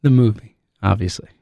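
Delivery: normal talking voice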